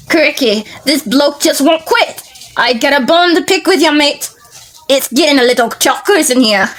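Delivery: put-on a comic crocodile hunter in a loose Australian accent. Reading Commando Crocodile's speech bubble in an Australian accent. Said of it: "I don't know what kind of accent that was".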